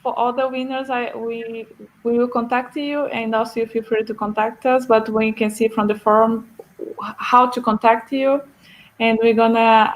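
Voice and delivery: ordinary talk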